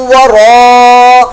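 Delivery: ورا held long after من with a merged nasal; slow ghunna demonstration; nasal merge. Shown as a demonstration. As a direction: let من run into ورا with ghunna. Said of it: "ادغام کا غنہ".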